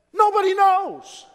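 high, loud preacher's answer; emphatic cry. Real, not acted. Preacher answering those asking when Jesus comes, high emphatic shout. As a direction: call it out high and loud to settle the question.

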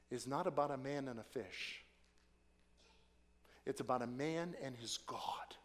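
hushed, breathless whisper; dramatic sermon whisper. Acted. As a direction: lean in and whisper the point. Preacher drops to a whisper.

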